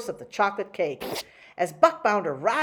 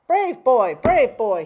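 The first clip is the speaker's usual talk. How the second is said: hearty praise; 7 semitones higher, 9 dB louder, slower than usual. Acted. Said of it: "he cried".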